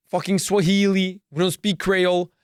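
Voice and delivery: usual speaking voice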